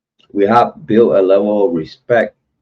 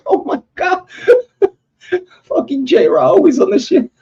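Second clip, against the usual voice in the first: high, laughing mock Mama J voice; imitating Mama J laughing. Laughing at a chat comment, high mock voice. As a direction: squeal it out through laughter, teasing Mama J. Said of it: "she's like".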